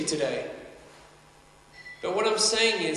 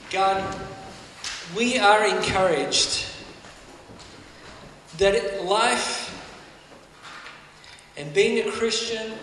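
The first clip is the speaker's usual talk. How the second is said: prayer. Real, not acted. I'm gonna pray for us.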